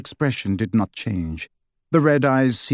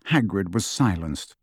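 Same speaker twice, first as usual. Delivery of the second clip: usual speaking voice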